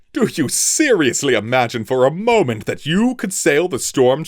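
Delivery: high, loud commanding captain; furious sky captain. A narrator's reading. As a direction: berate the traitor from the helm.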